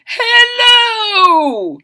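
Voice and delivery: big high shouted greeting; king's booming hello. Acted. The king greets the boy with a loud cry. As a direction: call out hello as a jolly king.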